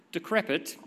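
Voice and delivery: usual speaking voice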